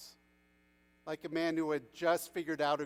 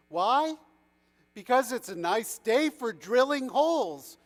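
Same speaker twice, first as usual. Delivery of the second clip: louder, higher, breezy retort; nonchalant; 6 semitones higher, 7 dB louder than usual. A narrator's reading. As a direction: toss it off as if obvious. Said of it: "he responded nonchalantly".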